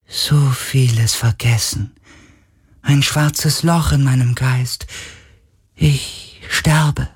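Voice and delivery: high, slow spirit voice; fading creature mourning itself; dying sea spirit, sorrowful. A narrator's reading. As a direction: lament drawn out in a high voice.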